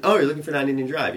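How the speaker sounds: understanding "oh" of a helpful cop; sympathetic. Retold or imitated. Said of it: "suddenly becomes, like, very sympathetic".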